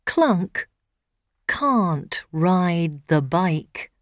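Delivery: usual speaking voice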